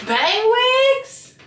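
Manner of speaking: high, loud hyped call; hyped shout. Real, not acted. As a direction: sell it like a hype host. Louder hyped repeat.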